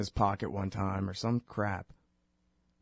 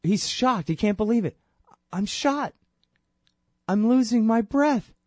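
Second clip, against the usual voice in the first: high, louder, breathless pleading; shocked plea; 10 semitones higher, 4 dB louder than usual. Retold or imitated. Shot man begging cop for breath, high and desperate. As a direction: relive the victim's gasping panic. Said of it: "in direct response to him saying".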